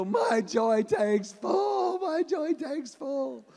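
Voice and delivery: put-on tickled kids begging their dad to stop, loud and high; breathless giggly shriek. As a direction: shriek it out while being tickled.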